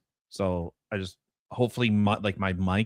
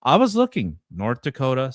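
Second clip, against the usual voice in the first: ordinary delivery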